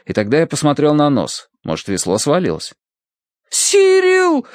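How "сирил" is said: very high, drawn cry of alarm; alarmed shout. A narrator's reading. Terence calls to his dog overboard, alarmed shout. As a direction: cry out the name in panic. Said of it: "закричал Терренс".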